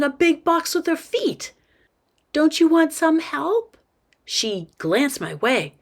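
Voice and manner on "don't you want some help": higher-pitched, lightly voiced girl; eager little girl. Girl offers help to new boy, eager kid voice. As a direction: pipe up like a keen kid.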